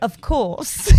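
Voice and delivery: clipped little British demo; posh-leaning British impression; British accent. Shown as a demonstration. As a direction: answer in a put-on British accent. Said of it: "Can you do it?".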